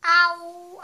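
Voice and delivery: sudden loud high yelp; mock-pained yelp at getting shot; startled ow. Real, not acted. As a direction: react as if actually hit. Shot in the face in game.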